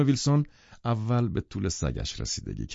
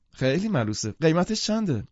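eager delight; 7 semitones higher than usual. Acted. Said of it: "با شور و شوق گفت".

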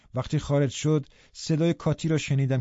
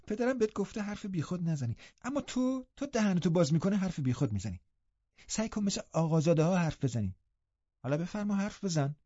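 higher, softer taunting voice; jeering young invalid cousin; 6 semitones higher, 5 dB quieter than usual. Acted. Youth telling cousin to speak like gentry, jeering. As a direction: scold him mockingly as a spoilt youth.

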